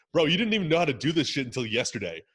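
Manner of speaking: incredulous dressing-down of a copycat; exasperated call-out. Acted. Coach to coaches copying his exercises, exasperated call-out.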